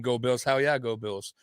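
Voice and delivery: ordinary talk, nothing marked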